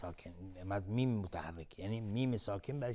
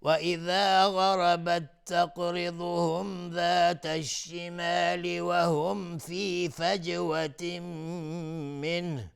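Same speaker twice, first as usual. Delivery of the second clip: slow, higher, melodic tajweed chant; Quran recitation; 6 semitones higher, 7 dB louder, much slower than usual. Acted. Teacher recites the verse for students, slow melodic chant. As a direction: draw out each verse like a reciter.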